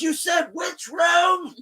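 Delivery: high, slow, loud yell; lost in the big house, frantic yell to Hailey. Retold or imitated. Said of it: "running around this big ass house".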